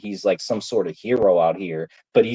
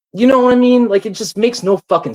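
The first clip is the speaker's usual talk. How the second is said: much higher, loud exasperated cry; exasperated outburst; 12 semitones higher, 7 dB louder than usual. Real, not acted. Ranting at the community, a loud exasperated outburst. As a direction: burst out in frustration. Peak of angry rant.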